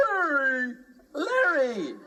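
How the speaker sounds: high, loud, whiny little puppet voice; a tearful-sounding puppet squeak; squeaky puppet. Acted. Puppet telling the host its name, high and whiny.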